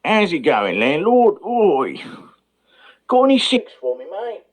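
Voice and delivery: high, swooping Cockney patter; Cockney pub regular. Acted. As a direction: hail the landlord in broad Cockney. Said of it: "you'll find some Cockney people around".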